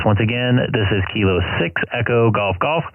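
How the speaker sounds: ordinary talk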